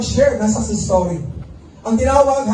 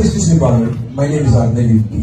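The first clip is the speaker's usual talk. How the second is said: much lower, deliberate formal voice; formal introduction; 11 semitones lower than usual. Retold or imitated. Meeting the speaker backstage, lower and formal. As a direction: introduce yourself like a manager. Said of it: "Sabi ko".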